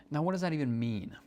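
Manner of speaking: usual speaking voice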